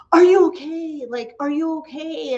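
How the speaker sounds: high-pitched mock-alarmed check on someone. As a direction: imitate someone urgently trying to rouse a sleeper. Lady shakes the sleeper awake.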